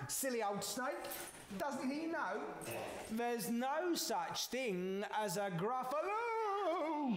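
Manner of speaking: high, gleeful little mouse; scoffing mouse. Acted. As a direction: scoff high, stretching out the last word. Mouse mocks the departed snake.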